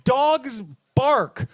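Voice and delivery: usual speaking voice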